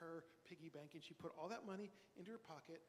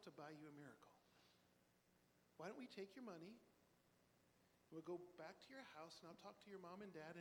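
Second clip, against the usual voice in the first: softer, hushed and kind; gentle reassurance; 2 semitones lower, 7 dB quieter than usual. Acted. Surgeon brother kneels to comfort the girl, gently and softly. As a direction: kneel down and reassure a small child.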